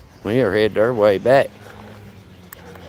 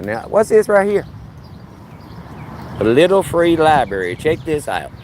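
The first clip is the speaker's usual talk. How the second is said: surprised discovery; 7 semitones higher than usual. Real, not acted. Spots a little free library.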